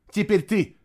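high, urgent prompt; hurrying someone. A narrator's reading. Boy rushes the warrior into portal, urgent. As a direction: press the next person to go. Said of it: "поторопил он Фенвольфа".

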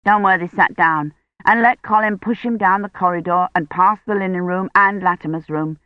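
usual speaking voice

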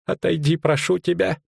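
high, urgent plea at ordinary volume; anxious pleading. Acted. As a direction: beg her to move away. Frightened innkeeper pleads with Ciri.

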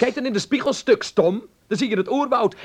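heated teasing insult; mocking scold. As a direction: taunt her about the mirror. Quarrel, he mocks her.

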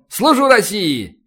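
high, drawn-out military acknowledgement; cheerful bark. A narrator's reading. Lieutenant answering the captain's thanks, cheerful bark. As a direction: bark it merrily, stretching the words. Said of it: "весело рявкнул лейтенант Зурабишвили".

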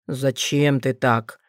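low, slow wounded voice; plaintive. Acted. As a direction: ask pleadingly, hurt. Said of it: "жалобно спросил оборотень".

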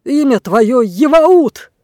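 slow, high, loud proclamation; triumphant sorcerer shout. Acted. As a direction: call out the true name like a spell. Blackbeard naming the dragon. (торжествующий возглас чародея)

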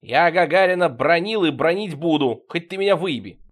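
defiant quarrel. A narrator's reading. Серёга keeps attacking Пашка in quarrel.

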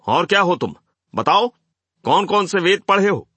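high, loud taunting questions; heckling challenge. A narrator's reading. Crowd to the man on stage, a loud challenge. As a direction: fire questions up from an angry crowd.

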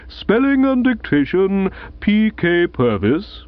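formal announcement, slow on the name; announcing. Acted. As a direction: read the prize out like a headmaster. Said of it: "announced the bearded bloke".

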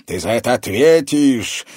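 put-on furious old man; through clenched teeth. A narrator's reading. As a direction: threaten him through clamped teeth. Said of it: "процедил сквозь зубы старик".

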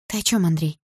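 understated shock; mildly shaken disbelief; stunned question. A narrator's reading. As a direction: ask as if taken aback. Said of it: "потрясённо спросила мама".

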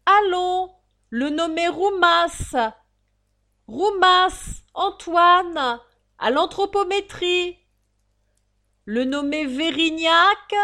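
high, loud, drawn-out crier's call; town-crier bark with stretched vowels; drawling roll-call shout. Acted. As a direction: bellow each name slowly to a crowd. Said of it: "appela une vingtaine de noms".

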